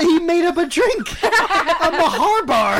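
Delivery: slow, laughing through the words; cracking up while telling it. Real, not acted. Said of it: "laughing retelling".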